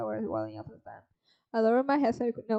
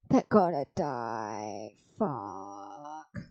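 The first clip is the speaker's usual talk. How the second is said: much lower, slow, hammed-up crying moan; mock despair; 12 semitones lower, 5 dB quieter, much slower than usual. Dreading the friends' fate, deep mock sob. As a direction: wail it like a fake sob. Predicting friends' deaths in game.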